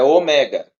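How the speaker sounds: "ômega" said oMÉga, stress on an open ME. Shown as a demonstration. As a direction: punch the middle syllable of "ômega". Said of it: "a forma correta de pronunciar".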